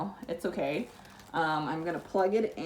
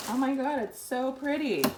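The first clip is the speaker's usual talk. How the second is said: excited; 8 semitones higher, 5 dB louder than usual. Real, not acted. Delighted at unboxed purifier.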